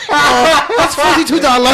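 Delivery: put-on high, loud Chinese-accented buyer; hammed-up Chinese accent, totting up the price; mock Chinese accent. Acts out restaurant buyer's reply.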